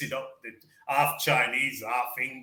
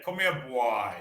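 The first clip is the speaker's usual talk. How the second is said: lightly imitated Caribbean "come here, bwoy"; a hint of Jamaican patois, boy as bwai. Teacher imitating islanders, lightly Caribbean patois. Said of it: "they speak like".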